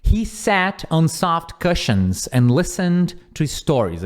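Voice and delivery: slow, careful read-aloud. Shown as a demonstration. Teacher reading the example sentence slowly to the class. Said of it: "Aqui a gente tem duas coisas".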